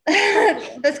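about to sneeze, then laughing; sneezing. Real, not acted. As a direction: sneeze through the words. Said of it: "I have to sneeze".

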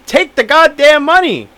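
blunt agent telling him to take the cash; mock agent yelling. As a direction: bark the advice like a fed-up agent. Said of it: "the agent comes in and be like".

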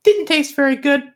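high, fast little bat voice. Acted. GM voicing the bat.

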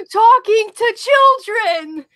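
loud, high-pitched manic yell; Crazy Steve. Retold or imitated. Quoting a favorite line by him, manic shouted impression. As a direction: bellow it like an unhinged TV character. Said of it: "that's my favorite line by him".

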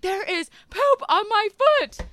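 mock wail of disgust at the poop; retold yell. Yelling at husband about poop on her foot, hammed-up. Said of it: "I yell at Travis".

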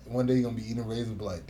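normal talking voice

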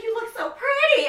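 high, loud, cooing voice; doting mom. Retold or imitated. Mom praising her look, high and cooing. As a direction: coo it sweetly. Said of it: "And she's like".

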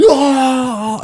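high-pitched mock scream after a gasp; gasp, then a drawn-out high cry; imitated shriek. Storyteller imitating the guys running away, mock shriek. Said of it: "they all just went".

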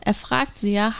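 ordinary talk, nothing marked

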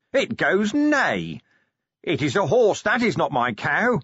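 high-pitched, insistent outburst; demented creature. A narrator's reading. Vimes raving at dwarfs about a horse, demented. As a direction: draw out the neigh, then protest high.

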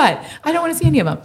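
fast, high laughing words. Real, not acted. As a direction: laugh through it. Laughing about hating clutter.

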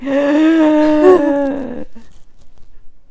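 long dramatic mock gasp; shocked gasp. Acts character's shocked reaction.

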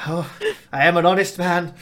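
loud, flustered Scottish protest. Acted. Sweating farmer protesting his honesty, loud Scottish. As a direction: blurt it out defensively, in Scottish.

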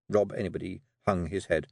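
ordinary delivery

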